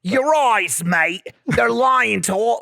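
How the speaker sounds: loud, slow mock British insult; hammed-up British chef glaring at a cook; British tough-guy jab; British chef accent. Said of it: "give me the words".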